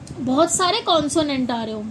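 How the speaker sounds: ordinary delivery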